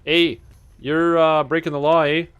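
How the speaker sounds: loud, high hammed-up Canadian cop; mock Canadian Mountie. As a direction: play a bossy Canadian Mountie, eh. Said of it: "they pop out and they go".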